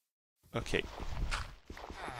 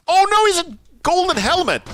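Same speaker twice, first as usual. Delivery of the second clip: high, loud panicked yelp; panicked cry; 14 semitones higher, 7 dB louder than usual. Real, not acted. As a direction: shriek at the armored zombie. Sees armored zombie.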